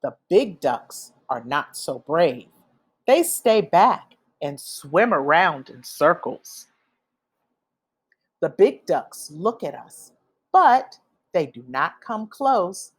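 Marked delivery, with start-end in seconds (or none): none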